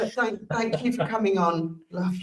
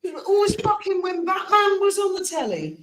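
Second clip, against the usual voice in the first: high, indignant complaint; exasperated high pitch; 10 semitones higher than usual. Real, not acted. Indignant childhood memory.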